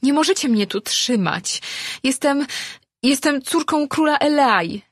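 high, indignant declaration; rising anger. A narrator's reading. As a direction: proclaim it with growing anger. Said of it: "oświadczyła, czując, jak wzbiera w niej gniew".

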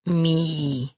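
lightly voiced small pet, one word; a hint of a stubborn little creature. A narrator's reading. Pet creature refusing to give back the ring, small voice.

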